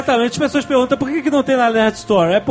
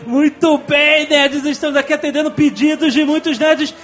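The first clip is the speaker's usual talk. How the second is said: big show-opener voice, higher and stretched; podcast announcer; 5 semitones higher, slower than usual. Acted. Host opening the show for the nerds, hyped announcer voice. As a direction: hype the audience like a radio host.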